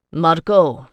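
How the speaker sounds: sharp, loud summons of a name; peremptory. A narrator's reading. Ricciardo summoning his brother, peremptory and loud. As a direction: call him to heel at once. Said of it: "he said peremptorily".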